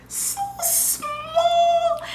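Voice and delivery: high, slow, amazed, laughing a little; awed new mom. Retold or imitated. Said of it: "I was like".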